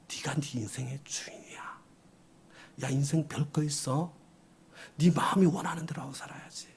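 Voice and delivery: hushed, tempting whisper in the ear; devil's whisper. Acted. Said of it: "이게 마귀의 속삭-, 속삭임이라는 걸". (유혹하는 마귀의 속삭임)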